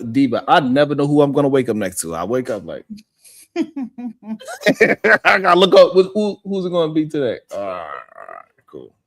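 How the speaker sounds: ordinary talk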